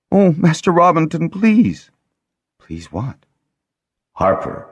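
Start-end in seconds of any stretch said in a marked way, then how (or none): 0.1-1.9 much higher, urgent plea; Lessa pleading. A narrator's reading. Lessa begging the Harper, much higher and louder. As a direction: cry out to him begging.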